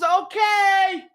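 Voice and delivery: high-pitched, singsong shouted reply; cheery agreement. Retold or imitated. Wife agreeing to the sudden trip, high singsong. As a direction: imitate a wife calling back. Voicing the wife's eager reply.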